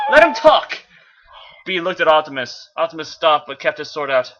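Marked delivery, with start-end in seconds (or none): 0.1-0.8 urgent call. Acted. B urgently calling to Optimus.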